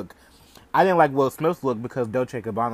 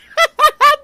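a piercing squeal of laughter; shrieking high laugh; 16 dB louder than usual. Real, not acted. Host shrieks with laughter at the news. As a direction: shriek with delight.

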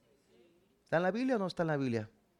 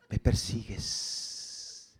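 fading whisper; 8 semitones lower, much slower than usual. Acted. God's voice fading on question.

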